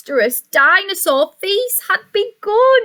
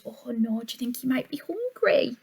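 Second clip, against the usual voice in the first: soft aside; 2 semitones lower, 10 dB quieter, faster than usual. Real, not acted. Aside to child listener, soft.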